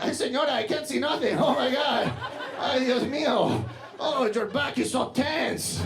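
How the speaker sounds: high Latin-accented masseur, hammed-up innocence; pretending to be blind. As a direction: draw out the fake helplessness in a high Spanish accent. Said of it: "they're just pretending to be blind".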